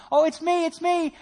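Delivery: hammed-up ghostly hello; mock shout. Jesus calling to the disciples from the waves, mock shout. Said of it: "shouting at him".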